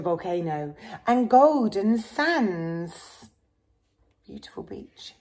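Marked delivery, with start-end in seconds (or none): 4.3-5.1 whispered aside. Real, not acted. Aside to child over book.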